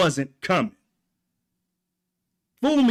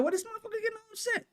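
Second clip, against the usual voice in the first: mock angry host; 9 dB quieter, much faster than usual.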